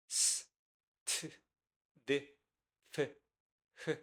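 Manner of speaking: S, T, D, F, H as short sounds, not letter names; S as a hiss, then T, D, F, H as little puffs; bare consonant sounds. Shown as a demonstration. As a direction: isolate S, T, D, F, H with pauses between. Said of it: "That is consonant".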